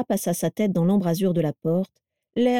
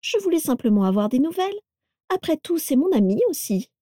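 put-on higher, falsely sweet mean girl; sly young woman; 8 semitones higher than usual. A narrator's reading. Carla voiced, sweetly false.

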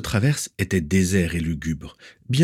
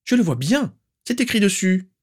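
put-on very high, sharp, demanding old aunt; shrill aunt; 12 semitones higher than usual. Aunt demanding where the niece is, shrill and stern. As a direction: pinch every word with disapproval. Walburga retorts.

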